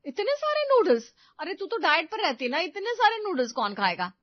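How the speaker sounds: high, loud astonished cry; mother-in-law shocked by the huge noodle haul. Acted. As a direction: gasp at the heap of noodles.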